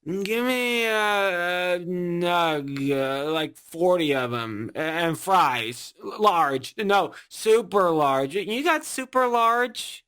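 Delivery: put-on slow, stumbling drunk order; drunken slurring. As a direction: drag and trip over the words like a drunk. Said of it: "slurring their order like".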